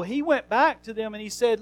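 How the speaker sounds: ordinary delivery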